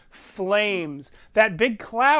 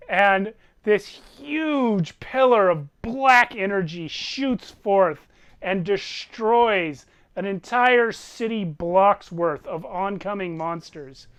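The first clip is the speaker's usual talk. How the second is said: ordinary talk, nothing marked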